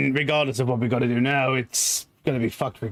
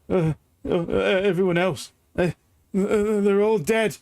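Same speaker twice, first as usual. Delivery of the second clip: higher, halting, stammered; shaken survivor; 6 semitones higher than usual. Acted. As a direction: stammer out the news in shock. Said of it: "he goes".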